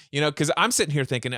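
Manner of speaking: ordinary talk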